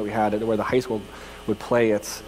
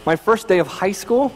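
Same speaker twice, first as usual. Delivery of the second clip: usual speaking voice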